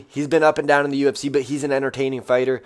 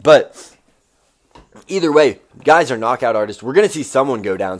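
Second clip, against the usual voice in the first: ordinary talk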